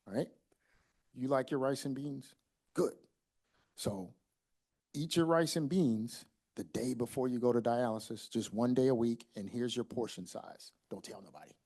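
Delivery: low, soft secretive voice; hushed conspiratorial aside. Acted. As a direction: confide a forbidden plan to a patient. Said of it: "I'll whisper to him".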